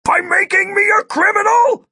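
very high, loud shouted question; outraged shout. A narrator's reading. Agent retorts to the hacker, outraged shout. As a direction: yell it back in outrage.

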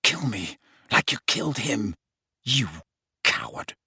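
soft, broken, breathless contempt; panting. A narrator's reading. Harry accuses Snape of murder, panting. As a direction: spit it out in spent fragments. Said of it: "panted Harry".

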